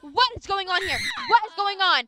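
mother yelling, demanding answers; furious repeated question; angry shout. Acted. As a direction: shout it, outraged. Mother confronts daughter.

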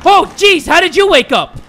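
high, loud yelp of alarm; startled shout. Real, not acted. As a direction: blurt it out loudly in shock. Startled by enemy waking.

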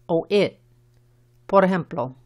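normal talking voice

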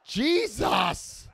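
high, sharp cry of "Jesus!"; startled shout. Real, not acted. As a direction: blurt it out, jolted.

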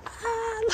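short high squeal of delight; excited squeal. Real, not acted. Fan spots the film house, excited squeal. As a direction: squeal high as you catch sight.

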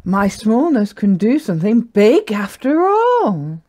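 dawning delight with a rising question; amazed little yak. Acted. Little yak realising smallness is useful, amazed. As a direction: build from wonder to excited realisation. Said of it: "Gertie gasped".

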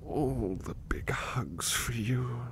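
slow, soft whisper; tender whisper. Acted. Butler hugging the listener, whispering slowly.

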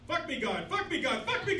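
normal talking voice

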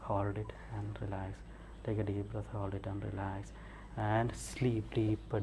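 soft, slow hypnotist's induction voice; hypnotic relaxation. Real, not acted. Hypnotist puts subject back under.

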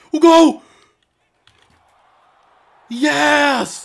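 high, loud cheering; thrilled shout; goal celebration. Real, not acted. Goal happens.

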